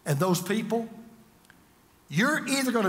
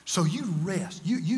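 ordinary talk

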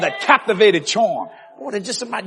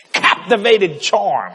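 ordinary talk, nothing marked

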